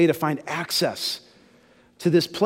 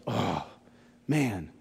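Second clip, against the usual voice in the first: a soft, much quieter deflated groan; dejected sigh; 9 semitones lower, 9 dB quieter than usual. Retold or imitated. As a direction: let it sink like a dejected soldier. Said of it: "he was feeling really dejected".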